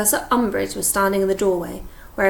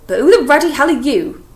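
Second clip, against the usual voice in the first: loud, higher, gruff challenge; blunt demand; 6 semitones higher, 9 dB louder than usual. Acted. Hagrid to Umbridge, loud gruff challenge. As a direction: confront the stranger head-on.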